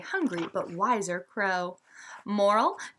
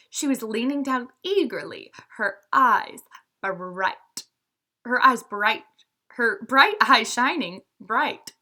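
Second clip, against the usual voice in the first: put-on lively read-aloud for young listeners; storybook narration; 3 semitones higher, 3 dB louder than usual. A narrator's reading. Teacher reading a fable to her students, lively storytelling.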